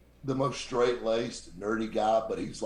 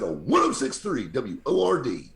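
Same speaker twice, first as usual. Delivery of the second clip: louder, punched-up station announcement; hundred-dollar radio ID; 3 semitones higher, 6 dB louder than usual. Retold or imitated. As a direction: sell the call letters like every take pays. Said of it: "like I'm gonna give you a hundred dollars".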